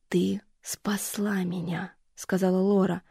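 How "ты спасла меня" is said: медленный беззвучный шёпот; прошепчи нежно, медленно; нежный шёпот. Acted. Мать шепчет утешая. (мать медленным шёпотом утешает дочь)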